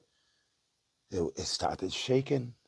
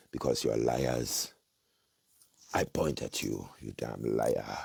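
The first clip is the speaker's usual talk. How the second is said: fiery preaching; 4 semitones lower than usual. Real, not acted. Prophet denouncing lying governments and churches, fiery preaching. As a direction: warn the false prophets with force.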